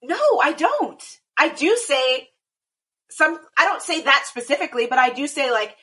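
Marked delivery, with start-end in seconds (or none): 0.0-1.0 indignant denial. Retold or imitated. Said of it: "and I'm like".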